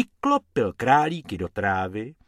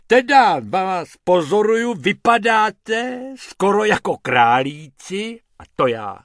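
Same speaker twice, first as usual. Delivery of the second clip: lightly voiced hippo, loud and pompous; a big animal introducing himself grandly; 8 semitones higher, 6 dB louder than usual. Hubert the hippo introduces himself, louder.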